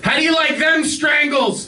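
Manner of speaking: high, slow Boston shout; Boston Strangler taunt. Acted. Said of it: "my impression of the Boston Strangler".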